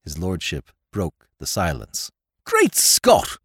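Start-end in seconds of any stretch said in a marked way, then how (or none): 2.4-3.4 put-on much higher, startled young lord's cry; Lord Drever crying out. A narrator's reading. Lord bursting from hiding, crying out in shock. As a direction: blurt it out in shock. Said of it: "he cried".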